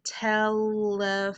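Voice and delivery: telephone slowly as tel-le-pho-NUH; drawn-out telephone with an extra nuh at the end; over-enunciated final N. Shown as a demonstration. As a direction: add a false "nuh" syllable to the end of telephone. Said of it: "create letters that aren't there".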